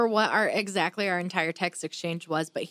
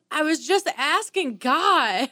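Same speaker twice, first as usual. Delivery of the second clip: imitating whiny brother; 7 semitones higher, 5 dB louder, slower than usual. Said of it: "And he goes".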